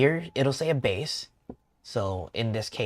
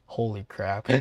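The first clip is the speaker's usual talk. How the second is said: usual speaking voice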